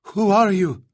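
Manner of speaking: high, panicky stammering; frightened stammer. Acted. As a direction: stammer it out in fear. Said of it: "the young woman stammered".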